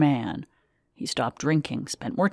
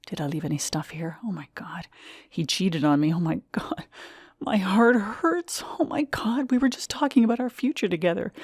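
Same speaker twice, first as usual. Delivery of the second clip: breathless whispered panic, jumping higher; a woman reeling as she learns he cheated, whispering in panic; panicked whisper; 4 semitones higher than usual. A narrator's reading. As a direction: whisper it in rising shock. Panicked inner thoughts after betrayal.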